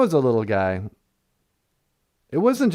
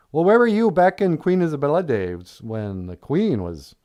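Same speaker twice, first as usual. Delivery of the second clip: ordinary talk, nothing marked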